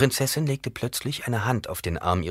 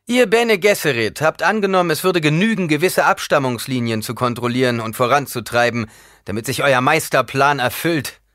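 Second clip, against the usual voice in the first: loud, high-pitched denunciation; scornful tirade; 8 semitones higher, 8 dB louder than usual. A narrator's reading. Paul attacking the Bene Gesserit's methods, scornful and loud. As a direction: berate them with raised voice.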